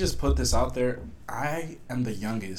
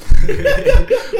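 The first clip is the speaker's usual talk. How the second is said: loud, high laughing fit; burst of laughter; 16 dB louder than usual. Real, not acted. Joking about home language, loud high laugh. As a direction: crack up at your own joke.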